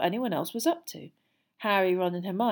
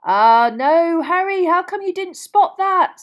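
loud, slow, rising teasing wail; mock dismay; 11 semitones higher, 13 dB louder, slower than usual. A narrator's reading. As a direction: draw it out high with a grin. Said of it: "grinning over from his own bed".

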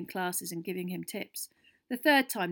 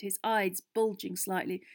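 usual speaking voice

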